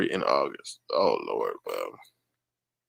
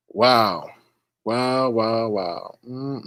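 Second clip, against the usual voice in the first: normal talking voice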